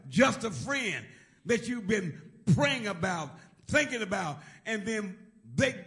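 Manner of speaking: loud, rhythmic preaching cadence; stirring sermon voice, rising and pounding; full-force preaching. Acted. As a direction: preach it out to the whole church.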